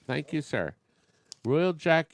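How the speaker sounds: ordinary talk, nothing marked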